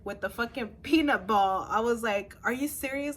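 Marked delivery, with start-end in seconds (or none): 2.4-3.1 higher-pitched incredulous question; retold disbelief. Patient questioning the nurse, incredulous. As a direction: ask it as if you can't believe it.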